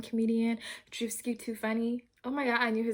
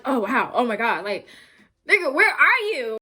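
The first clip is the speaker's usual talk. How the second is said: excited; 3 semitones higher, 8 dB louder than usual. Retold or imitated. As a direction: shout it out in amazed disbelief. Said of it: "It's like".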